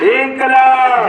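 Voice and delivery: high shouted slogan call; booming political rally speech. Real, not acted. Leads slogan chant at rally.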